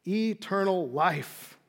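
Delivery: very slow, drawn-out words; savouring awe. Real, not acted. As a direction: stretch each word out in wonder. Sermon climax, savouring eternity.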